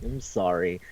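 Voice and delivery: retold apology, small and hushed; meek apology. He apologizes for hurting them, meek and quiet.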